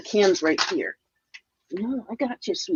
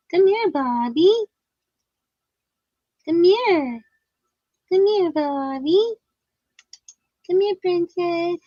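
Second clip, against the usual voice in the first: calling a pet; 8 semitones higher than usual. Real, not acted. Calling the cat sweetly.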